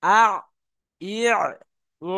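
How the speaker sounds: আ, ই, উ each squeezed shut at mid-throat; ayn with three vowels. Shown as a demonstration. Teacher models how to pronounce the letter, demonstrating. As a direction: drill আ, ই, উ as ayn, pausing after each. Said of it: "এইরকম ভাবে আমাদের অক্ষরকে উচ্চারণ".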